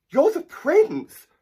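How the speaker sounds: put-on nervous boy blurting it out in a stammer. A narrator's reading. Boy meeting the prince in the woods, stammering in shock. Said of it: "stammered Jack".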